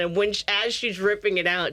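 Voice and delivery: ordinary delivery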